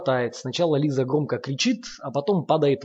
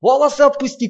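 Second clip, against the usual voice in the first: much higher, louder shriek; pained scream; 12 semitones higher, 10 dB louder than usual. A narrator's reading. Sasha screaming at Lomov to release her hair, shriek. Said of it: "кричит Саша".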